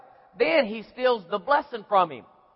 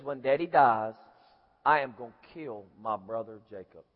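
very low, softer brooding voice; secret murderous vow; 12 semitones lower, 7 dB quieter than usual. Retold or imitated. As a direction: sink deep into a private threat. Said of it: "what Esau says in his heart".

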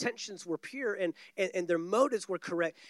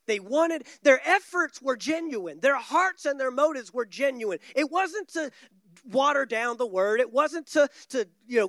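higher, louder preaching, pressing the point; a preacher lifting his voice to insist; rising preacher intensity; 3 semitones higher, 3 dB louder than usual. Acted. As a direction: preach it, building to defend their motives.

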